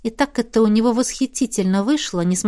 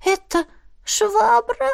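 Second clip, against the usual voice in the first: high, slow shocked squeak; appalled little animal voice; squeaky otter voice; 11 semitones higher, much slower than usual. A narrator's reading.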